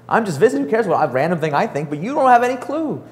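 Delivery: high, airy, faux-innocent shrug of a voice; lightly mocking high voice to a team sure it caused the growth; mock innocence. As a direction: play the harmless visitor, needling them. Mock-innocent reply to pissed teams.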